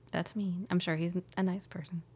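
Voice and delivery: fast unvoiced whisper; whispered aside. Real, not acted. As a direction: whisper it quickly, half-guilty. Whispered apology about driver.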